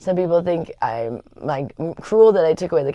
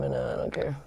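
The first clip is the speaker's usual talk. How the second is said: soft whispered shrug; whispered aside; 3 semitones lower, 10 dB quieter than usual. Real, not acted. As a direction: whisper it like a little secret. Defiant aside about her kid room.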